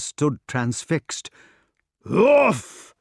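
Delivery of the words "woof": booming, higher-pitched bark noise; loud imitated "woof". A narrator's reading. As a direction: imitate a heavy crash as a woof. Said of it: "cried the door again".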